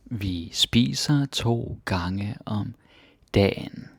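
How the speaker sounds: "Vi spiser to gange om dagen" at half speed, every word separate; slow teacher repeat of "Vi spiser to gange om dagen". Shown as a demonstration. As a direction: spell it out slowly for the learner.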